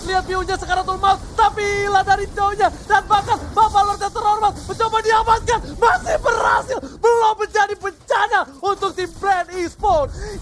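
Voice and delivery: high, loud shouting caster; shouting play-by-play. Real, not acted. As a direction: scream the call as the Lord fight explodes. Caster screams over Lord fight.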